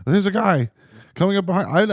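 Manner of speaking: mock wife alarm. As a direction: play the anxious passenger. Wife's quote retold.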